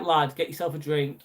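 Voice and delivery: ordinary delivery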